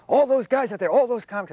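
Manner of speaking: lightly hammed-up Rodney pointing at the comics; imitating Rodney. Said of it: "he says".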